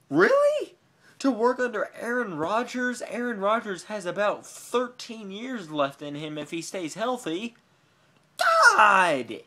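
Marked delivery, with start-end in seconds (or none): 0.1-3.8 shrill, loud disbelief; incredulous. Real, not acted. Fan reacting to a Packers trade, loud and incredulous. 8.4-9.4 loud, exasperated cry; exasperated shout. Real, not acted. Fan fed up with the rumours, shouting.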